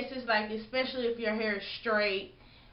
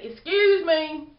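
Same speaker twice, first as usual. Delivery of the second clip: loud, slow, drawn-out excuse me with attitude; snippy drawn-out excuse me, louder; rude excuse me; 6 semitones higher, 8 dB louder, slower than usual. Shown as a demonstration. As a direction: lean on excuse, make it sound rude. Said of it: "like that".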